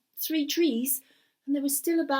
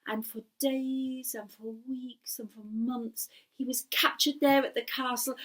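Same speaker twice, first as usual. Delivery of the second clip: ordinary delivery, nothing marked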